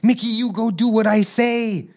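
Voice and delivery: high, loud bossy mogul voice; flaunting boss. Retold or imitated. Teacher imagined bossing Mickey around, high and pushy. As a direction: order Mickey around like a rich tyrant. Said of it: "if he had money and he's like".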